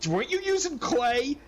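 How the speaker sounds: high, laughing accusation; pointed question. Retold or imitated. Husband confronts wife about clay, high and laughing. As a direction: ask it knowing the answer, laughing. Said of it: "and, like".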